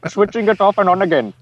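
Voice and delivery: high mock Indian accent, amused. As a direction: play tech support with a put-on Indian accent. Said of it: "in an Indian accent".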